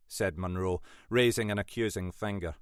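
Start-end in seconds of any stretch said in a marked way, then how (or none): none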